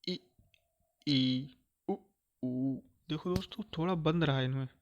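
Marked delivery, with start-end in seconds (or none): 0.0-2.8 slow classroom vowel drill; vowel demo. Shown as a demonstration. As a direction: sound out आ ए ऐ ओ clearly like a teacher. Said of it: "जैसे हमने देखा".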